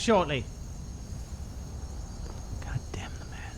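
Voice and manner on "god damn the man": under-the-breath whispered curse; whispering. Real, not acted. Muttering alone while playing.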